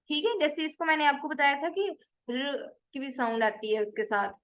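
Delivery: ordinary delivery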